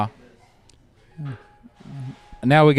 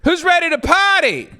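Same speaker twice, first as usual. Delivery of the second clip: rowdy party animal; 12 semitones higher than usual. Acted. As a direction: fire up the room like a party host. Hyping up the crowd.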